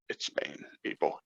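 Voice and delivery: hushed, insistent whisper; whispered aside. Acted. Whispering to people who deny it's Spain, insistent.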